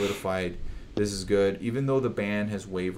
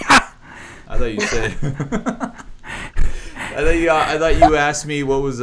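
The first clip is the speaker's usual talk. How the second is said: laughing loudly through the words, pitch jumping up; 6 semitones higher, 9 dB louder than usual. Real, not acted. To the host, laughing at his own misheard question. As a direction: crack up mid-sentence at your own mix-up.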